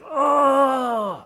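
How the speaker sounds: loud, high anguished cry of anger; angry bellow. Retold or imitated. Jesus facing his friends' loss, an angry bellow. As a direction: bellow out grief and rage. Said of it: "why did he bellow in anger?".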